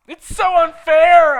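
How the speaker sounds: high, loud, slow wail; whiny wail. Acted. Heroine lamenting her beauty to Draco, high slow whiny wail. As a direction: wail it like a self-pitying teen. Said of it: "I yielded".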